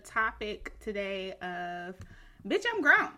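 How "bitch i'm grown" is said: sassy declaration. Pretend. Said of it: "I just wanna say it just like that".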